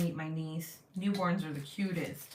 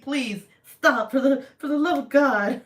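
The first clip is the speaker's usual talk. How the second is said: exasperated plea; 7 semitones higher, 10 dB louder than usual. Retold or imitated. Exasperated parent quote to toddler.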